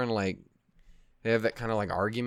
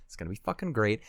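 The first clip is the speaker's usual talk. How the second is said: usual speaking voice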